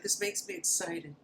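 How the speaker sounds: soft, high put-on girl voice; imitated excited girl. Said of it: "the girl, she's like".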